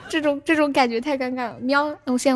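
ordinary delivery

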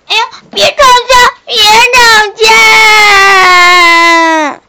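high, loud, drawn-out begging cry; pleading shout. Acted. Dolphin begging frog and shark to stop quarrelling. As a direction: beg them to stop fighting. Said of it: "小海豚说".

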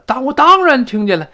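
"当然" punched high and loud. Acted. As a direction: hit "当然" hard, indignantly. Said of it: "这of course在这儿要重读了". (“当然”又高又响地重读)

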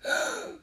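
sharp acted gasp; shocked gasp. A narrator's reading. Priests finding the ginseng tree fallen, shocked gasp.

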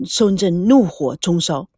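ordinary delivery

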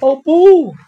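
high, loud cry of alarm; cried out. Acted. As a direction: yelp it out in storybook dismay, high and loud. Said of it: "艾博尔叫道".

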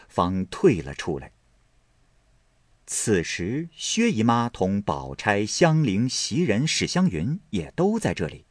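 ordinary delivery

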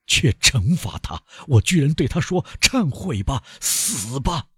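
high, strained self-accusation; dragged out in torment; anguished self-reproach. A narrator's reading. Villefort recalls condemning his wife, anguished.

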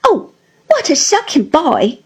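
high, mock-scandalised wife; lightly exclaimed mock reproach; Dora's exclamation. A narrator's reading. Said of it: "cried Dora".

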